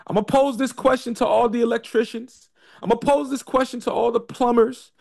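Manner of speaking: rising rhetorical build. Real, not acted.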